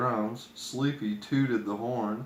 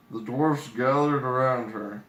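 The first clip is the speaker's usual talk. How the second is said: usual speaking voice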